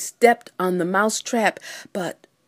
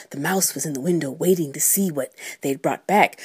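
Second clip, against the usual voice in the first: ordinary delivery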